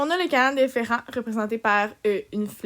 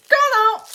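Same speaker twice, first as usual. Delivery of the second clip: condom punched much higher and louder; punchline emphasis; 10 semitones higher, 8 dB louder than usual. Acted. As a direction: land "condom" as a loud, high punchline. Punchline word for the class.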